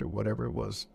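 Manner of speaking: ordinary talk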